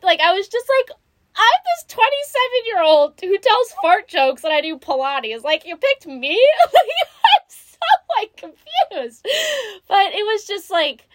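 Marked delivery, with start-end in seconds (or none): 1.4-6.4 high-pitched, incredulous protest; acting out amazement at being the one picked; comic disbelief. Retelling her reply to her spirit guide, incredulous. 6.4-9.3 high, loud laugh through the words; laughing bewilderment. Retold or imitated. Telling her mentor about being picked, laughing and baffled.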